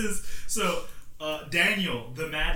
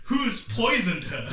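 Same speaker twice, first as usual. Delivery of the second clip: mock dramatic gasp; 8 semitones higher, 5 dB louder, slower than usual. Dramatic twist read aloud.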